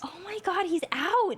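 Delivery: high squeal of delight; shrieking jackpot winner. Real, not acted. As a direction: squeal with pure joy. Character appears again.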